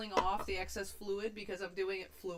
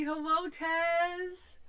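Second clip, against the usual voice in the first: stretched greeting; 8 semitones higher, 5 dB louder, much slower than usual. Real, not acted. Greeting an old coworker.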